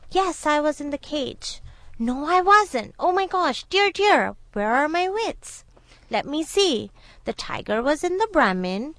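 high-pitched mock confusion; flustered jackal. A narrator's reading. Jackal playing dumb for the tiger, flustered dithering. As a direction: fret and scold yourself, playing muddled. Jackal pretends to be hopelessly muddled.

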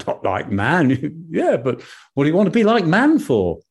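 high, quick, incredulous rabbit voice; puzzled rabbit. Acted. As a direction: rabbit baffled by the moon's wish.

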